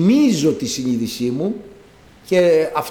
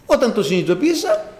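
ordinary delivery

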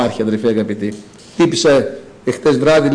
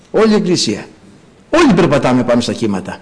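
normal talking voice

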